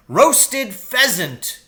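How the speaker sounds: father crying out to the whole countryside; cried out. A narrator's reading. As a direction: proclaim it slowly to the moon. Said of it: "he cried out".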